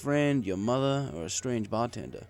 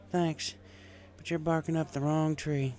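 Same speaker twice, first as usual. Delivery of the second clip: country mare; 6 semitones higher than usual. A narrator's reading. Applejack voiced in story.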